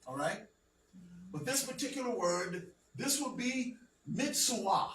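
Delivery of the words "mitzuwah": "mitzvah" as mits-oo-WAH, no V; ancient Hebrew pronunciation. Shown as a demonstration. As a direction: sound the wav in "mitzvah" as a vowel, mits-u-wah. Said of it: "That's how you would say it".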